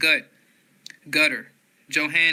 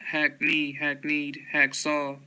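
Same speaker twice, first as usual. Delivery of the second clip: ordinary talk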